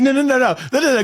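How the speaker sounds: high, laughing no no no; tossing it back and forth, giggling; playful high-pitched back-and-forth through laughter. Retold or imitated.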